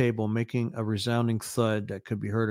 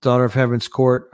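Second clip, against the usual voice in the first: usual speaking voice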